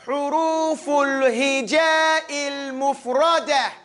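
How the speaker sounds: "huruful hija'il mufradah" slowly chanted, long vowels; corrected recitation. Shown as a demonstration. Teacher correcting students, slow chanted "huruful hija'il mufradah". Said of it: "It's not hurufa".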